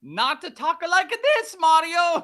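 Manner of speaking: put-on a goofy Italian brother, high and stretched out with an Italian accent; Luigi voice. Co-host jokes with Mario, laughing, in a high Italian accent. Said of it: "not to keep going with this voice".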